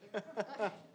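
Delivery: short, light burst of laughter. Real, not acted. Waiting to present to the board, laughing. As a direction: laugh briefly before getting started.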